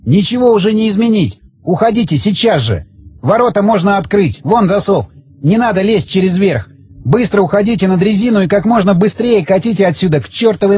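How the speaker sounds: high, urgent orders, mildly yelled; yelling. A narrator's reading. Man with the bomb orders friends out, mild high yell. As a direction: press them to leave, pitch held high. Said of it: "заорал Загорский".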